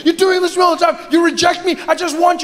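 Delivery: high, pleading whine; rejected boyfriend whining at his partner, high; whiny boyfriend. Retold or imitated. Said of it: "As soon as he starts losing it".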